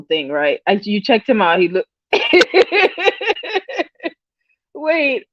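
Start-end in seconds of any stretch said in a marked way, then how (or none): 2.1-3.8 a high giggle; high laughter. Real, not acted. Host loses it again mid-story, high giggle. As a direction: lose it again.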